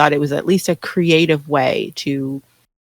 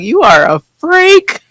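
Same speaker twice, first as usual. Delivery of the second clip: high, slow, freaked-out voice; incredulous dude; 12 semitones higher, 6 dB louder than usual. Retold or imitated. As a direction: gape at them in disbelief. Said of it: "He's just looking like".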